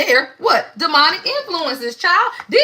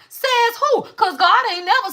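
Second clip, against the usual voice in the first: indignant challenge; 9 semitones higher than usual. Real, not acted. Challenging the one-way view of God.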